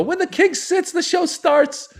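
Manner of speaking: very high, loud, drawn-out ending; emphatic decree. Retold or imitated. Comic passing promoter's rule to late host, high frantic proclamation. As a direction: proclaim the royal rule in mock alarm.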